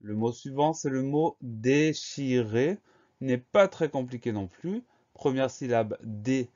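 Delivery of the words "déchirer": "déchirer" slowly split into dé-chi-rer; slow, careful "déchirer" for spellers; sounded out syllable by syllable. Shown as a demonstration. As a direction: dictate "déchirer" one slow syllable at a time. Teacher sounds out word for dictation.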